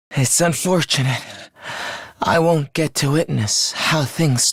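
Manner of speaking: low, weak and halting; dying last words. Acted. Dying farewell to Takumi and everyone, weak and halting. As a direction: labour through each phrase between long breaths.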